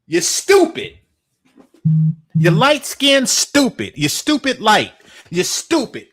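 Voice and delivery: furious scolding shout; loud insulting rant. Real, not acted. Ranting insults at the man.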